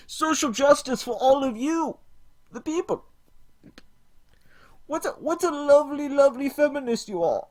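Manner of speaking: hammed-up parody of a preachy feminist YouTuber; parody Lacey Green. Streamer mocks Lacey Green to chat, high mocking voice. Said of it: "For I am Lacey".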